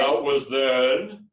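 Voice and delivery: booming and drawn out; loud slow voice. Shown as a demonstration. Coach showing a changed voice, loud and slow. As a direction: call it out slowly and loudly. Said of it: "And you can change your voice".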